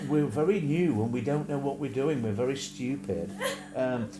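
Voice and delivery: mocking his own nervous first stream; mocking nervous newbie. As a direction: shuffle through it like a nervous first video. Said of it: "And I started off something like".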